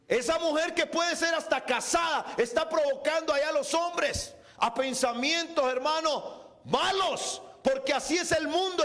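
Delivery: rising into shouted, driving sermon; fervent preaching. Real, not acted. Preacher warning the church it belongs to Jesus, fervent preaching. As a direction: build to a relentless pulpit cry.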